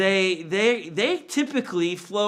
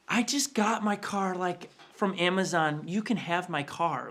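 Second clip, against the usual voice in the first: softer, gentle little-brother voice; soft offer; 7 dB quieter than usual. Retold or imitated. Younger brother calmly offering his own car. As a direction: offer it quietly and kindly.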